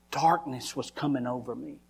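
normal talking voice

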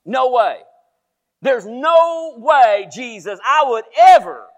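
loud, high, drawn-out refusal; acted-out emphatic protest; vehement protest. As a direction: stretch the words in dramatic, indignant denial. Peter's vehement protest to Jesus.

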